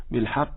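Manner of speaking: بالحق ending in an aspirated k-like sound; breathy qaf like kaf. Shown as a demonstration. Teacher shows what not to say, whispered-release qaf. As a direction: let breath escape on the ق of بالحق. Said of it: "لا تقل هكذا".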